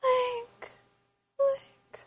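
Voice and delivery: high breathy whisper calling Link. Retold or imitated. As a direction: breathe the name out, high and airy. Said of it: "you'd hear Zelda's breathy voice".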